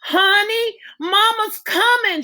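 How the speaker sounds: high, loud reassuring call; mother calling to her daughter in labor; crying out. Acted. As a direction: call ahead while running down the hall. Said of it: "she cried as she ran".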